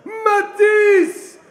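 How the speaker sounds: stretched, roaring fighter name; drawn-out hype shout. Real, not acted. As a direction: boom the name out long and loud. Announcer hypes fighter's name.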